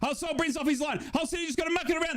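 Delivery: high, fast football commentator voice; playful commentator. Pretend. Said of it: "consider being a commentator 'cause you're fantastic".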